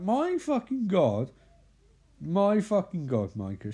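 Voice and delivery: slow, high mock outrage; exasperated. [name] fed up with Micah's teasing, mock exasperated. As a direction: stretch it out in rising exasperation.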